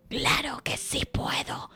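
whispered sly reply; sneaky whisper; whispering fox. Acted. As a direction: answer like a stealthy fox. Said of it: "sigiloso como es él".

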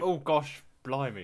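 hammed-up fake British accent; bad TV British accent. Friend mocking British accents put on for TV, hammed-up British. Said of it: "not the shitty British accents that people normally put on".

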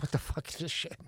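hushed, breathy aside so the father won't hear; whisper at dinner. Retold or imitated. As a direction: whisper it under your breath at the table. Dinner table, hidden from dad.

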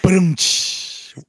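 joking rimshot; vocal rimshot. Acted. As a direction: close the joke with a drum sting. Punchline rimshot after pun.

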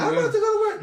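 high mock girlfriend line; mocking his girlfriend. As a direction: squeak it out like the girlfriend brushing him off. Acting out his girl's reply.

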